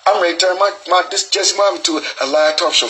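normal talking voice

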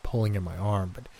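usual speaking voice

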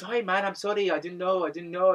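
a sheepish guy begging forgiveness; mock apology.

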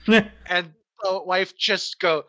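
normal talking voice

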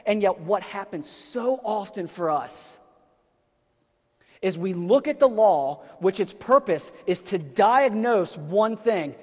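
slow, loud, weighty pulpit delivery; rising sermon fervour, slow and loud; preaching at full force. Acted. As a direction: lean in and hammer each phrase slowly.

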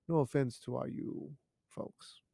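ordinary talk

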